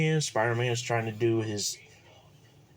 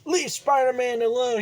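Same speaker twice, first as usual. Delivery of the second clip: high, loud shout of defiance; shouting crowd; 13 semitones higher, 5 dB louder than usual. Acted. New Yorker yells at the Green Goblin to leave Spider-Man alone. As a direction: yell it to protect the hero.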